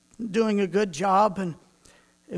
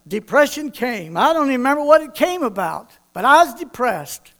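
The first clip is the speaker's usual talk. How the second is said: ordinary talk, nothing marked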